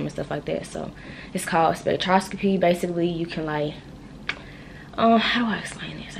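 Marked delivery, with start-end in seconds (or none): 5.0-6.2 whispered aside. Real, not acted. Muttering to herself mid-explanation.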